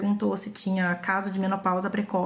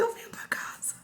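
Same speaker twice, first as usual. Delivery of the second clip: whispered promise; 9 dB quieter than usual. Retold or imitated. Father's tender promise retold.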